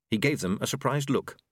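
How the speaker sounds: ordinary delivery, nothing marked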